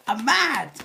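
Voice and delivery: indignant outburst on someone's behalf; really mad. Real, not acted. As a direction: let the anger rise in the voice. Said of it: "it's got me that enraged".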